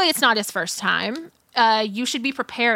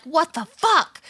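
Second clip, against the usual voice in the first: mock angry exclamation, pitched up; pissed-off cursing; 6 semitones higher, slower than usual. Storyteller voicing the client backing out, imitated pissed-off cursing. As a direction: retell his outburst with raised pitch. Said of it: "He's hella pissed".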